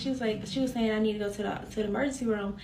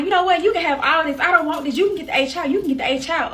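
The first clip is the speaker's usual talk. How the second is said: loud, higher retold outburst; angry dismissal; 6 semitones higher, 7 dB louder, faster than usual. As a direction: throw them out in an argument. Retold fight, throwing partner out.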